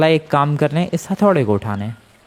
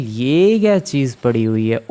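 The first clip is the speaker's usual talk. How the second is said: usual speaking voice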